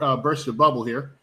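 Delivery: ordinary talk, nothing marked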